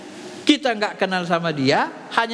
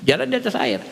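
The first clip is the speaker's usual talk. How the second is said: ordinary talk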